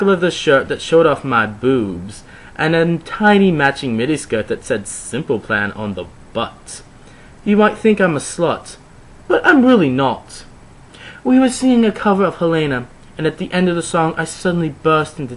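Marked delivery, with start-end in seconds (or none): none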